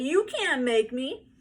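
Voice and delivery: high, loud, slow stubborn shout; defiant yell. A narrator's reading. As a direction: dig in and yell back. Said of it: "yelled Howie".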